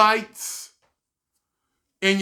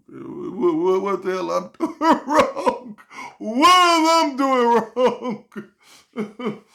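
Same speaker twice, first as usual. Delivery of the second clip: laughing exasperation; slower than usual. Retold or imitated. Vlogger asking why the channel fails, laughing despair. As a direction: wail in mock despair, laughing. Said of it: "You're like".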